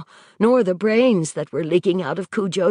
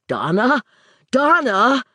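shouting her name; calling out; 4 semitones higher, 5 dB louder than usual. A narrator's reading. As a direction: call out urgently. Vic shouting for his wife.

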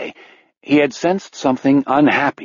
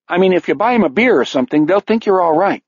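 higher, quick anxious barman; hurried jittery bartender, pitched up; nervous bartender; 7 semitones higher, faster than usual. Acted.

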